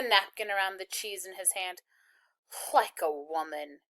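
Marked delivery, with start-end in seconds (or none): 2.7-3.8 low, slow contempt; contemptuous. A narrator's reading. Soldier mocking the lunch, low contempt. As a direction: spit out the insult slowly. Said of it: "he added with contempt".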